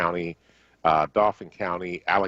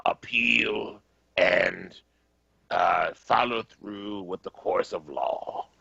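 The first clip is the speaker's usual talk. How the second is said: slow, drawn-out grumbling politician; Mitch McConnell; much slower than usual. Retold or imitated. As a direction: grumble each phrase out very slowly, with long pauses. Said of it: "I'm trying to do my Mitch McConnell impersonation".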